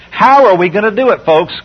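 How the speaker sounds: ordinary talk, nothing marked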